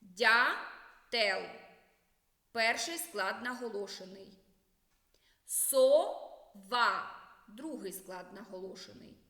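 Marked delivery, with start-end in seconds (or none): 0.0-1.4 loud, «Дятел» split into syllables, first stressed: ДЯ-тел. Shown as a demonstration. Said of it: "Вимовляємо слово по складах". 5.4-7.2 loud, «Сова» split into syllables, second stressed: со-ВА. Shown as a demonstration. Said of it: "Вимовляємо слово по складах".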